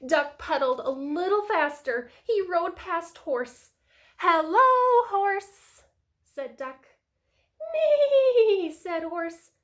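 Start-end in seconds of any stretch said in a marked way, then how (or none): 4.2-5.8 high, loud, drawn-out greeting; Duck calling hello. A narrator's reading. Duck greets Horse in story. 7.7-8.7 loud, high, wavering whinny; whinny like a storybook horse; horse neigh. A narrator's reading.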